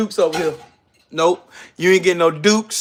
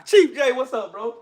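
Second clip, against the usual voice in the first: usual speaking voice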